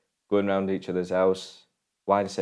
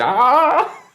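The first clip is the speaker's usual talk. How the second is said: high squawking crow cry, much higher; 6 dB louder than usual. Retold or imitated. Crow on Uncle Billy's shoulder, a high squawk. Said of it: "His crow's going".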